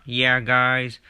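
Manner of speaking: ordinary talk, nothing marked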